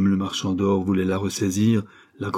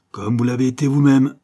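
loud, higher angry comeback; stinging retort; 4 semitones higher, 6 dB louder than usual. A narrator's reading. Banker answering the countess's accusation, stinging retort. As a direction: throw the charge back at the other.

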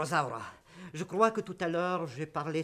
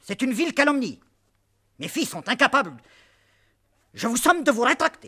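loud indignant outburst; outraged; 6 semitones higher, 12 dB louder than usual. Acted. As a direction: shout it, outraged. Father outraged at slander.

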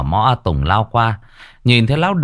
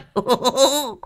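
hearty laugh. A narrator's reading. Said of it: "cười hô hố".

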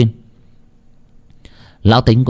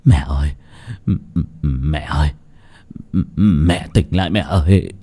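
lower, stammering, broken calls; horrified; 7 semitones lower than usual. A narrator's reading. Old son calling to his dead mother, horrified. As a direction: stammer in horror, breaking between words. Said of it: "Lão Tính kinh hãi".

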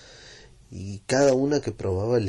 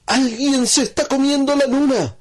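put-on far higher, louder child's cry; alarmed exclamation; 8 dB louder than usual. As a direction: blurt the discovery in shock. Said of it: "Y de repente dijo".

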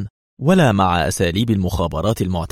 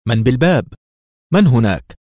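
higher and louder, tense and alarmed; tense call; 4 semitones higher, 5 dB louder than usual. A narrator's reading. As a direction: call out nervously at the door. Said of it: "في توتر".

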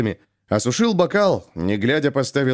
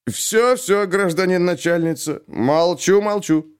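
apologetic backing down; 6 semitones higher, slower than usual. A narrator's reading.

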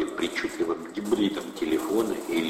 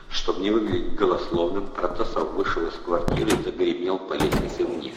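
ordinary delivery, nothing marked

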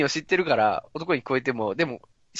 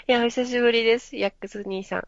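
put-on higher girlish voice greeting; female voice; 7 semitones higher than usual. Shown as a demonstration. Greeting big brother Yax in a cute female voice. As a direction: greet him sweetly in a girl's voice. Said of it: "女声がこれになります".

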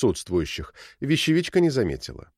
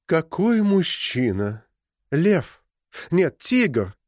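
slow, languid swooning sigh; breathy Hollywood starlet drawl; 4 semitones higher, much slower than usual. A narrator's reading. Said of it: "томно протянула".

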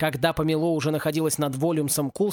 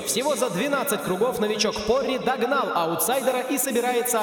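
higher, lively sports commentary; race commentator; 5 semitones higher than usual. A narrator's reading. A race commentator shouting over the broadcast, lively play-by-play.

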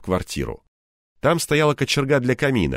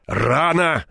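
higher, insistent protest; stubborn objection; 6 semitones higher than usual. A narrator's reading. Narrator stops his partner cutting rope, stubbornly insistent. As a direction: try stubbornly to stop him. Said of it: "упрямо пытался остановить его я".

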